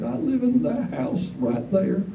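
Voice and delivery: put-on high, slow old man; the old man again, high and slow; frail old man pointing to his house, high and slow. Old man voiced in story.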